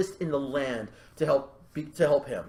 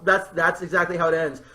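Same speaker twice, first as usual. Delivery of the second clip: usual speaking voice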